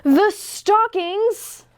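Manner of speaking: high, stretched, thrilled cry; excited realization. A narrator's reading. Mouse realizing the stockings hold a present, thrilled cry. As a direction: gasp it out with sudden joy.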